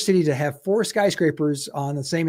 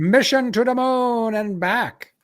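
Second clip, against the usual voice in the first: put-on old-timey announcer; 4 semitones higher, 5 dB louder than usual. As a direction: announce it like an old-time emcee. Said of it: "I'm not sure why I'm talking like an old-timey announcer now".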